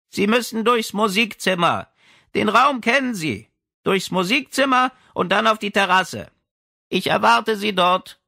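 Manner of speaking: high, light and loud voice behind a door; girlish old lady. Acted. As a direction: speak as a sprightly old woman, bright and high.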